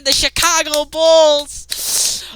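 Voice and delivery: slow, loud, stretched-out team introduction; arena announcer. Acted. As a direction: boom it out like a stadium announcer. Announcer call repeated.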